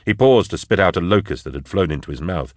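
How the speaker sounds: ordinary delivery, nothing marked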